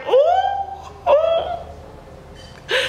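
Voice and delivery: high, breathy mock whimper of shame; mock wail. Sister whimpering as she leaves, rejected, mock wail. Embarrassed after being rejected.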